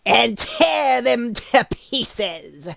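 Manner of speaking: high, slow, wicked witch voice. Acted. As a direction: sneer it like a cruel witch. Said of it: "said the witch".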